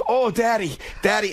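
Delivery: imitated little daughter. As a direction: lightly voice an excited child calling Dad. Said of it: "And she goes".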